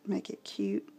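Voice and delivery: whispered aside to herself. Real, not acted. Crafter murmuring to herself at the card, whispered. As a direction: breathe it under her breath while working. Aside while placing the cardinal.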